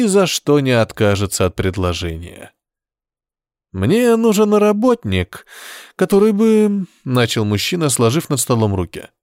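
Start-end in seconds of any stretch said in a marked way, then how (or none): 3.7-6.9 high, slow sly tempter; devil's voice. Acted. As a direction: purr it like a devil. Demon-like man offers job. (голос дьявола)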